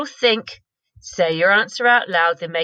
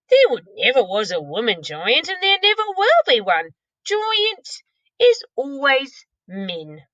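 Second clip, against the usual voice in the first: higher, louder insistent giant; 7 semitones higher, 4 dB louder than usual. Acted. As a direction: giant laying down the law.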